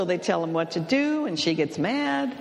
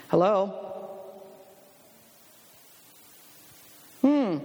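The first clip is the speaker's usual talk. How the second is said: terse husband on phone; 3 semitones higher, 3 dB quieter, slower than usual. Retold or imitated. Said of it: "this is how the conversation went".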